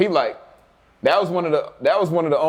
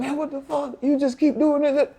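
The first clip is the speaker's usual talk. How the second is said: much higher, slowed, exasperated teammate voice; mock teammate; 10 semitones higher than usual. Teammates baffled at the freshman, in a high exasperated mock voice. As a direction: play the baffled teammates, pitched way up. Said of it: "So they like".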